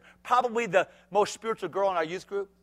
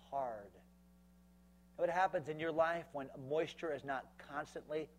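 hushed preaching; 3 semitones lower, 11 dB quieter than usual. Acted. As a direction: pull them in with a hush. Dropping to an intimate hush.

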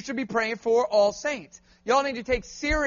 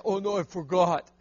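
1 lightly voiced sheepish boy; 5 semitones lower, 3 dB quieter than usual. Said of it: "And say".

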